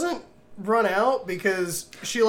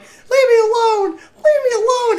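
terrified scream; 7 dB louder than usual. Retold or imitated. Victoria screaming at Art.

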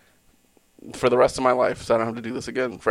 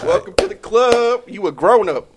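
loud, high mock cheer; excited; 10 semitones higher, 7 dB louder than usual. Real, not acted. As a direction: shout it like a proud welcome. Teasing co-host, mock congratulation.

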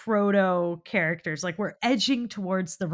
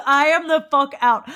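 mock exasperated outburst; 8 semitones higher, 10 dB louder than usual. Retold reaction, laughing.

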